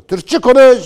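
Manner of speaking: loud, high mock yell; imitating a shout. Commentator imitating a critic shouting at him, loud high yell. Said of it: "diye bağırıyor".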